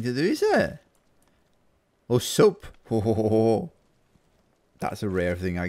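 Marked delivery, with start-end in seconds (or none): 2.1-3.7 hearty Santa-style ho ho ho; jolly ho ho laugh. Real, not acted.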